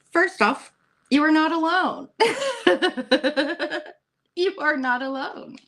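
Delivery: high, laughing reassurance. Real, not acted.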